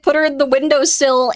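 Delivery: normal talking voice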